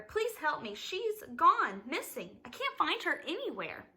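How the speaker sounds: high, panicky plea for help; frantic plea. A narrator's reading. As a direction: plead frantically, voice jumping up. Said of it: "cried frantically".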